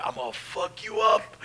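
high, strained retold threat; pained threat. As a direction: threaten through pain, half-hearted. Threatening laughing friend while hurt.

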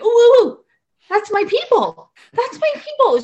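high, loud, gushing with delight; thrilled high squeals of ooh; excited squeal. Retold or imitated. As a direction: squeal like you just got the best news. Eager reply to high-needs student.

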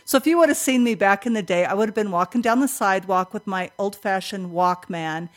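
usual speaking voice

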